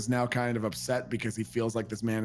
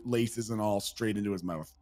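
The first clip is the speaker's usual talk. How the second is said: ordinary talk, nothing marked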